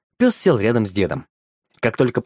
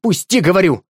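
louder, higher angry order; worked-up demand; 7 semitones higher, 4 dB louder than usual. Acted. Woman lets rip at the keeper, angry. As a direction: lose your temper and demand it. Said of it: "разошлась женщина".

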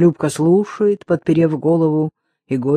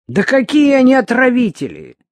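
громко и высоко, с возмущением; воскликни от всей души; возмущённый возглас; 8 semitones higher, 6 dB louder than usual. A narrator's reading. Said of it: "глубоко воскликнула Любка". (горячий, громкий возглас протеста)

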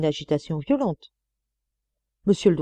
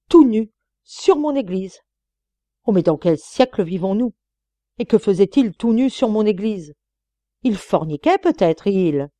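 choking anger; 4 semitones higher, 6 dB louder than usual. Acted. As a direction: spit out the words through a tight throat. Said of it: "s'empourprait de colère".

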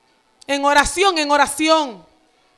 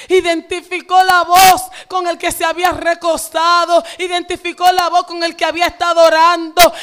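louder, insistent preaching cadence; fervent preaching; 2 semitones higher, 4 dB louder than usual. Acted. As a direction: hammer the repeated line. Preaching at full force.

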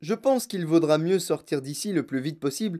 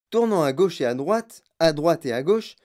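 ordinary delivery, nothing marked

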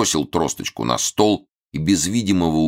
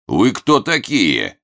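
slow, loud, menacing demand; 7 semitones higher, 5 dB louder, much slower than usual. A narrator's reading. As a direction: growl it out, threatening. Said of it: "грозно спросил он".

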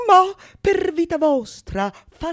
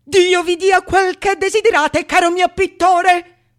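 raised, fervent parting cry; loud exclamation; 4 semitones higher, 8 dB louder than usual. Acted. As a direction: bless him loudly as you leave. (esclamazione fervida e forte)

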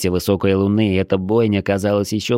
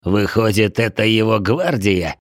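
grumbler's derisive question; mocking. A narrator's reading. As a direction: poke fun at his so-called guard. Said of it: "насмешливо продолжил Ворчун".

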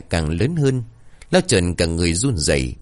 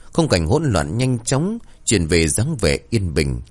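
usual speaking voice

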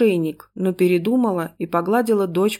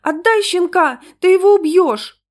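high, loud, strained plea; desperate child; 11 semitones higher, 7 dB louder than usual. A narrator's reading. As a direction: plead frantically in a little girl's high voice. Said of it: "отчаянно зашептала она".

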